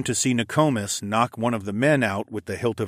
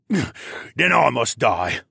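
slow, defiant grumble in character; slow grumbling prisoner voice; grumbling captive; much slower than usual. A narrator's reading. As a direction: grumble it out, resigned to die. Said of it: "the man I held grumbled".

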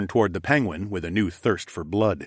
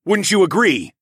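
high loud rallying call; rousing shout; 11 semitones higher, 8 dB louder than usual. A narrator's reading. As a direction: whip up the crowd. Rousing the crowd.

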